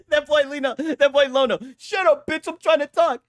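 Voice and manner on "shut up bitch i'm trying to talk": mock snarl. Repeats the funny lyric.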